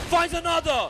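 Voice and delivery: high, loud hype call; excited shout. Real, not acted. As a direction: yell the kill. Caster calls a kill.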